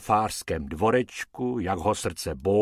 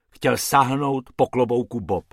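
ordinary delivery, nothing marked

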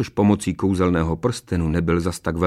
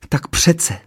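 high-pitched exclamation; excited cry; 8 semitones higher than usual. A narrator's reading. Princess learning the dove is her mother, crying out. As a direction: cry out as a hunch proves true. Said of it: "vykřikla Arabela".

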